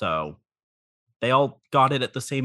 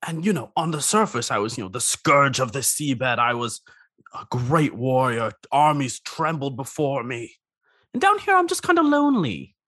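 lightly voiced lonely crab; a hint of a needy crab; crab character voice; 6 semitones higher than usual.

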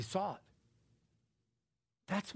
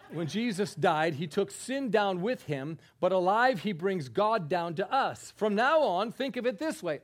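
loud, higher-pitched emphatic reading; preaching scripture reading; 5 semitones higher, 8 dB louder than usual. Acted. Preacher reading the Message Bible to the congregation, emphatic. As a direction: proclaim the verse with force. Reads Bible passage with force.

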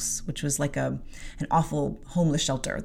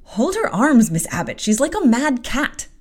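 higher, louder servant's cry; urgent shout; 7 semitones higher, 6 dB louder than usual. Acted. Bessie to Miss Abbott, urgent shout. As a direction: yell for help holding her down.

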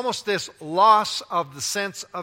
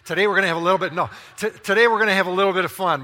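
louder, revved-up preacher launch; preaching at full force; 7 dB louder, much faster than usual. Shown as a demonstration. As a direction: hit it like a pulpit opener, full energy. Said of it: "Okay, gonna preach this way".

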